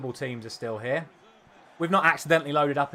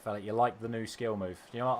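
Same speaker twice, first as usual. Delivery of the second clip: normal talking voice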